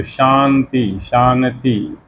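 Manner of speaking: slow शांति with the nasal as n; pronouncing shanti. Shown as a demonstration. Teacher slowly demonstrating शांति to students. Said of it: "वो न का उच्चारण कर देते हैं".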